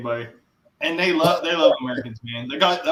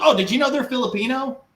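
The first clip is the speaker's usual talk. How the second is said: higher, excited wife's question; bright surprised quote, pitched up; wife sharing her discovery, higher and louder; quoting wife; 11 semitones higher, 4 dB louder than usual. Retold or imitated. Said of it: "She goes".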